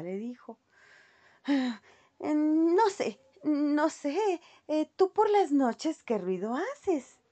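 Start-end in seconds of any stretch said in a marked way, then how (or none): 1.4-7.2 voz aguda y lenta de ratita; ratita coqueta. A narrator's reading. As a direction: duda coqueta, estirando las palabras. La ratita responde al gallo. (ratita al gallo, aguda y coqueta)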